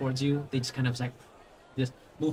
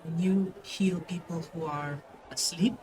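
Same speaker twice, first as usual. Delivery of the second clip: put-on small child; 3 semitones higher, 3 dB quieter than usual. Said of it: "And he goes like".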